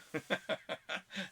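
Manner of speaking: high staccato comic giggle; Pee-wee Herman chuckle. Retold or imitated. Host quotes Pee-wee Herman's post, high mock chuckle. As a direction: chuckle in short, high bursts. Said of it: "he posted".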